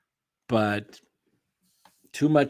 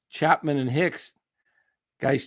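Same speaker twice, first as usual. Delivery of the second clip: usual speaking voice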